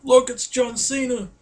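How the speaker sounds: high-pitched excited announcement to friends; excited call-out. Retold or imitated. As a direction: call it out high, thrilled to spot a star. Excitedly telling friends Cena has appeared.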